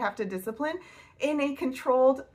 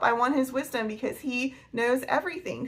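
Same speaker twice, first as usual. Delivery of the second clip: ordinary talk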